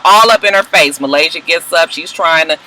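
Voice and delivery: normal talking voice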